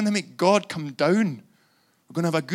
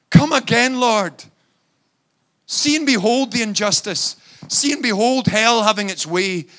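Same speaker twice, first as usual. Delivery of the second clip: fervent loud prayer; 4 semitones higher, 8 dB louder than usual. Acted. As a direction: cry the prayer out loud and high, full force. Prayer at full force.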